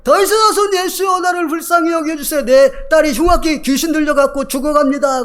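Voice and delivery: high, loud desperate plea; pleading cry. Retold or imitated. Canaanite woman begging Jesus for her daughter, high loud plea.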